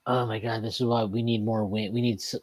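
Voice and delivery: low, repetitive monotone mimicry of complaining fans; Donald Trump. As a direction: drone the same complaint over and over. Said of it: "That was a good Trump im-, impersonation".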